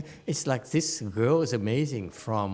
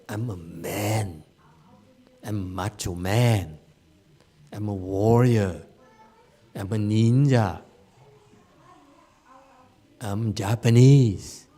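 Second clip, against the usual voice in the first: mock macho boasting; 4 semitones lower than usual. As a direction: declare each title like a proud tough man. Voices the proud ego's attachment.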